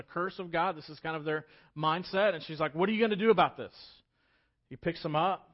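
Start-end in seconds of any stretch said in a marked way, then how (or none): 2.7-3.7 louder, higher imitation of a frantic mother; retold frantic demand. Mother confronts the man of God over her son, mock frantic. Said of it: "you can imagine the franticness of this".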